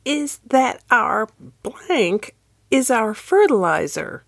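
a hint of a frail elderly lady; quavering old woman. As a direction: lift it into a shaky old voice. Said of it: "in the quavering voice of the elderly".

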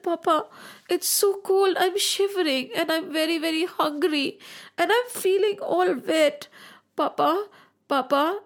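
put-on high, small child's voice, cold and hungry. A narrator's reading. Little son tells his father he is cold. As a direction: plead like a shivering little boy.

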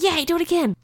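high, delighted squeal of encouragement; excited cheer. Retold or imitated. Mum egging her son on to ride again, excited cheer. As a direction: cheer it on, pitched right up.

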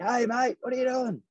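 high startled call after someone running; mock guard yell. Security guard calls after driver bolting across bridge, high mock yell. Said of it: "And he's like".

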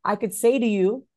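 ordinary delivery

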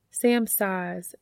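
usual speaking voice

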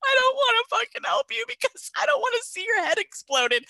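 very high, wailing imitated dread; hammed-up squealing reluctance; mock panic. Medic dreading the sight of the victim, mock-panicked wail.

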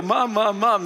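put-on loud, high child calling for a parent; imitated kids calling. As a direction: call out insistently, mimicking your kids. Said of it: "when your kids go".

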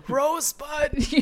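slow, breathy last gasp; dramatic dying words. Pretend. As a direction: whisper it like a dying man. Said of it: "your last words being".

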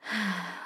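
long, soft exhale; deep sigh. Real, not acted. Podcaster wound up after a rough day, deep sigh. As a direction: let out a deep breath. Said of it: "let's just take a deep breath".

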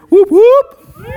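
high, loud whoop for the announcement; excited cheer. Real, not acted. Pastor cheering the congregation about Recovery Live. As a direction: cheer it up like a hype man. Hyping a church event.

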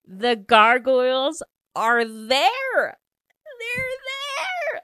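slow, loud reveal rising to a squeal; overjoyed shout. Real, not acted. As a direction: shout it drawn-out, breaking into a high shriek. Overjoyed reveal after the suspense.

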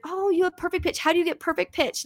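lightly voiced amazed students; students' voices. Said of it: "my students will be like".

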